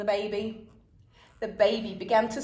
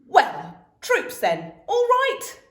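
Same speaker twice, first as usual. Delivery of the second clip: loud playful command; rally the troops; mock sergeant; 12 semitones higher, 7 dB louder, slower than usual. A narrator's reading. Dad leading kids in chores, playful command.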